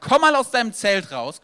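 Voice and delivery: loud, high call to come outside; loud call, stress on "mal". Acted. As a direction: call him out of the tent with force. God calls Abraham out.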